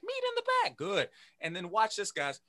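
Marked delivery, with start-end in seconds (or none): 0.0-0.8 put-on high kid voice echoing; mocking kid echo. Imitating students, a high echo back to teacher. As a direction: squeak it back like a pupil. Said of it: "Everybody say".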